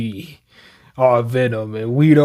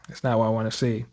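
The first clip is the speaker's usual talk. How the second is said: dismayed sigh; 6 semitones lower, 12 dB quieter, much faster than usual. Real, not acted.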